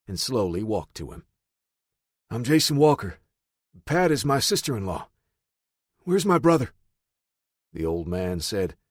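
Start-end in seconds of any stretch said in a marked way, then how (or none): 2.3-6.7 calling out. A narrator's reading. Calling to armed old man.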